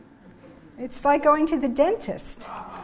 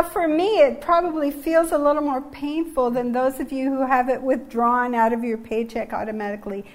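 normal talking voice

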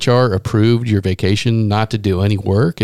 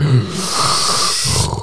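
imitated gasp. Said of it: "long intake of breath".

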